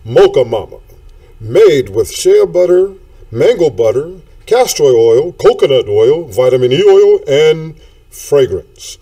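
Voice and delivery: hammed-up infomercial pitch; commercial voice. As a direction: sell it like a radio ad. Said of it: "Let me put on my commercial voice for y'all".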